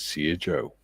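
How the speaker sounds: ordinary talk, nothing marked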